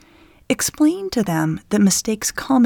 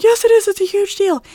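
put-on high, loud insistent kid; upset child; 12 semitones higher, 6 dB louder than usual. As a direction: argue back like a panicking six-year-old. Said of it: "And the kid's like".

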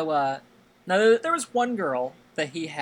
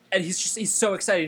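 ordinary talk, nothing marked

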